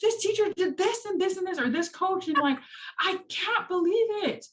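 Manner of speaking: high excited ranting; worked-up teacher venting to husband; retold venting. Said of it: "I'm like".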